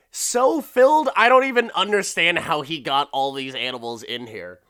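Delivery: real amazed outburst; astonished. Real, not acted. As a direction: exclaim it like you can't believe your eyes. Own astonished reaction.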